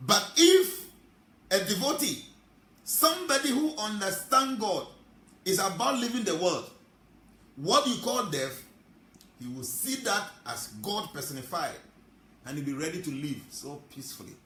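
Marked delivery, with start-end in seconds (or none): 0.1-11.8 very high, very loud peak of the sermon; preaching climax. Acted.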